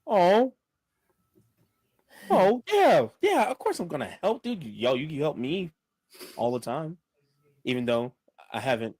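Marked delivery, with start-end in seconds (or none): 0.1-4.6 high, loud burst of eagerness; eager agreement. Acted. Promising a friend help with the quest, eager. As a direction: jump in with a big high-pitched yes.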